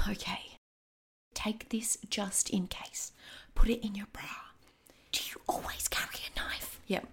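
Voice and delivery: tense secretive whisper. Acted. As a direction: hand it over in a hushed voice. Handing over a knife, hiding.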